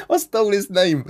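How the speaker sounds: high, laugh-filled voice; cracking up. Real, not acted. Gamer bragging he stole a troll's name, laughing. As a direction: get the words out through real laughter.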